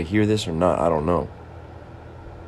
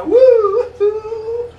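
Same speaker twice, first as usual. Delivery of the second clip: whoop; 10 dB louder than usual. Acted. Excited whoop in silly bit.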